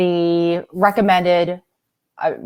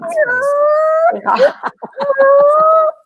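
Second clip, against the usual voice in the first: long high laugh; 5 dB louder than usual. Real, not acted.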